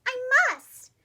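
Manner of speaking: high, loud resolve; determined. Acted. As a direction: say it fiercely, a must. Determined exclamation.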